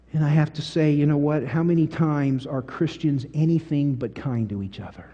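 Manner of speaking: normal talking voice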